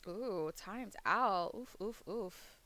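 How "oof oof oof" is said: ordinary delivery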